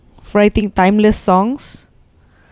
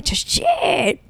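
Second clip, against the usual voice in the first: amazed; 7 semitones higher, much slower than usual. Real, not acted. Awe at the album's layers.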